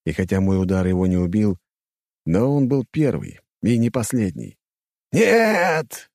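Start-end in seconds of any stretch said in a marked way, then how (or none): 5.1-6.1 high, loud furious roar; huge fiery dragon roar. Acted. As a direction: roar it with all your rage. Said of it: "взревел дракон-император". (яростный рёв дракона)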